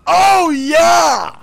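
high, loud outburst; loud, heated exclamation; exasperated shout. Real, not acted. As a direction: yell it in exasperation. Sarcastic outburst at players.

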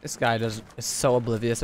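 usual speaking voice

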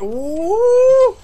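long, loud, high-pitched "ooh"; startled whoop. Real, not acted. Viewer reacts to the reveal, startled high whoop. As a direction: let out a loud rising whoop. Startled by what is being watched.

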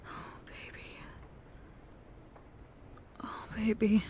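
breathy, hurting whisper; heartbroken whisper. Acted. Whispering in sorrow to his girlfriend about her not eating. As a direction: barely voice it in sorrow. Partner hears painful admission.

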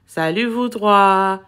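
low, slow, downcast greeting; sad voice. A narrator's reading. Boy greeting his three pets, sad voice. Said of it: "répondit d'une voix triste".

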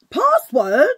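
loud, high questioning cry; surprised outburst. Real, not acted. Vlogger caught out by her new laptop's demand, surprised shout. Computer unexpectedly demands a password.

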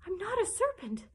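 high-pitched indignant denial. A narrator's reading. Alice denies the pigeon's accusation, indignant. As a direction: protest the accusation, pitch well up. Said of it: "said Alice indignantly".

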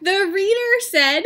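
lively, high character voices for a picture book; two story characters. Acted. Teacher voicing Gerald and Piggie for students. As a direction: act out Gerald and Piggie for the class. Reads Elephant and Piggie aloud.

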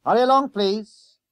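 loud, high hurrying call. A narrator's reading. Conductor hurrying passengers along, loud call. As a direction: chivvy passengers off the bus. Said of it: "called the conductor".

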